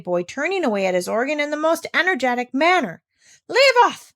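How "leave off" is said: high shrill-pitched scream, much louder. A narrator's reading. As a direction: scream it over the organ music. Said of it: "she screamed".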